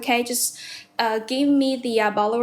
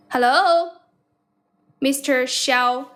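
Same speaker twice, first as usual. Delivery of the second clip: calling out; 3 dB louder, much slower than usual. Real, not acted. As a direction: call out to a caller who isn't answering. Calling out to silent caller.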